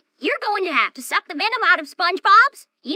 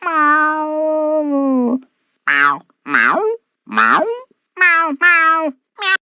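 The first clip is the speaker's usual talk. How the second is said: snail meowing; much slower than usual. Acted. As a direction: meow like a little pet snail. Pet snail meows.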